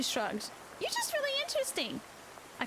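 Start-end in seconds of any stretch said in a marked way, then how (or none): 0.8-2.0 put-on young girl voice. A narrator's reading. Girl's quote, very high voice.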